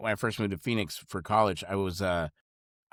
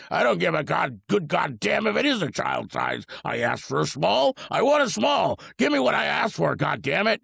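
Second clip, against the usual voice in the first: put-on old man's yell, louder and higher; retold angry yell; 6 semitones higher, 4 dB louder than usual. Old man yelling at the clerk over a small, mock angry. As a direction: bark it back at the smug clerk. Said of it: "old man fucking just yelled at him".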